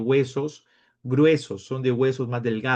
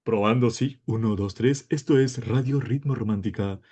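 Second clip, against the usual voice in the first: mock radio host doing a mic check; imitated station announcer; 3 semitones lower than usual. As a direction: put on a smooth radio DJ voice. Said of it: "ya te vuelves un locutor de radio".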